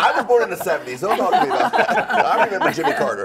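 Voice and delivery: fast, amused protest. Real, not acted. Host laughing, louder and faster.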